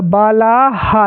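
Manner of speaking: slow "बाला हा", haa set apart; visarga echoing vowel. Shown as a demonstration. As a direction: stretch out "बाला हा" with an aspirated last syllable. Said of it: "last में हा का उच्चारण करना है". ("बाला हा" धीरे, अंत में हा)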